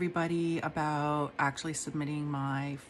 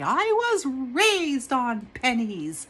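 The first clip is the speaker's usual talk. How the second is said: slow, higher, dramatic declaration; inner character voice; 9 semitones higher, 7 dB louder, much slower than usual. Retold or imitated. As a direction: announce it slowly like a revelation. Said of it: "my character in my mind say".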